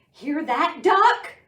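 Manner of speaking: rising loud call; barking dog. Acted. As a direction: bark the question up to Duck. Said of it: "Dog barked".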